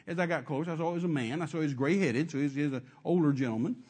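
normal talking voice

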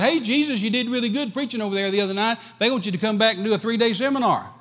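high, fast and eager pitch; eager followers. Acted. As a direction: sell it high and quick like a promoter. People urge Jesus to stay.

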